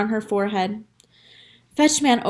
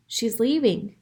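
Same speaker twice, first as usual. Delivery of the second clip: ordinary delivery, nothing marked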